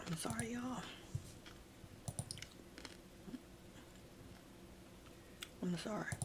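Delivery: real emotion breaking through; choked up. Real, not acted. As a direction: apologize while the tears come. Tearing up retelling story.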